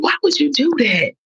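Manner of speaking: high-pitched, incredulous challenge; retold disbelief. Girlfriend confronts boyfriend over the text, disbelief. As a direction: demand an explanation, retelling it.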